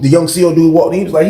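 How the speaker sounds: plain reading voice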